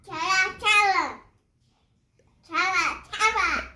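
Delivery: high monkey chatter. Shown as a demonstration. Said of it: "monkey make?".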